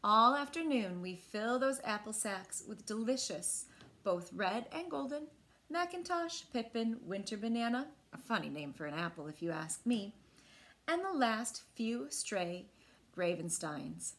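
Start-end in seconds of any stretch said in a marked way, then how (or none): none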